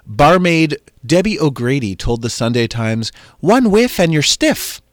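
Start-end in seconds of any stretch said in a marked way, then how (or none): 3.4-4.8 high, loud, hammed-up Irish brogue; Irish villager voice, badly faked; terrible Irish accent. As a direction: do a bad Irish accent, pitched up and punchy. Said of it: "That's my terrible Irish accent".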